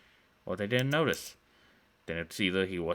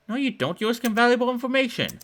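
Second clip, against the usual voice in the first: put-on angry girl; 7 dB louder than usual. Girl argues with Jake.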